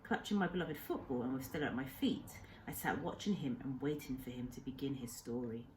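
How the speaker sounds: ordinary delivery, nothing marked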